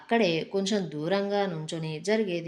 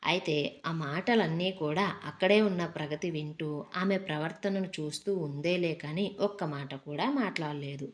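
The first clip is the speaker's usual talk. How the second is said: plain reading voice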